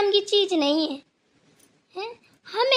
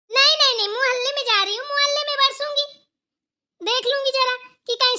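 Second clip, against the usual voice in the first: sarcastic retort; 5 semitones higher than usual. Acted. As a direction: throw it back with scorn. Sarcastic comeback in the quarrel.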